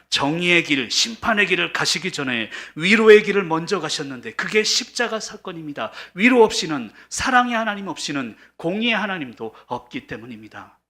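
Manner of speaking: loud, high, urgent pulpit delivery; full-force preaching. Real, not acted. Pastor proclaiming comfort before judgment, preaching at full force. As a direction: drive it home with mounting fervour.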